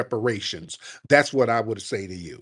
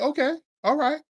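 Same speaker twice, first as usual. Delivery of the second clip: sarcastic high okay; 13 semitones higher, faster than usual. Retold or imitated. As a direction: say it like you're humoring them. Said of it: "We were like".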